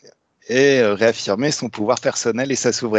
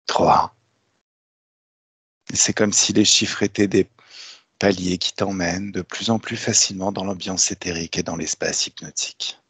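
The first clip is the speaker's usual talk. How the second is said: guided hypnosis; 4 semitones lower than usual. Acted. Leads a hypnotic induction.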